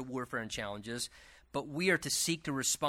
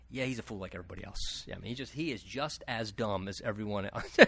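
dismissive onlookers; 7 semitones lower, 7 dB quieter than usual. Pretend. Imagined people judging a fool.

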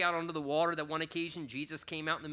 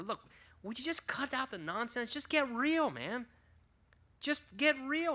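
exasperated demand; 8 semitones higher than usual. Retold or imitated. Exasperated friend demanding honesty.

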